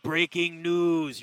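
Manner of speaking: slow, drawn-out announcer liner; breaking news liner. Shown as a demonstration. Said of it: "give me a breaking news liner".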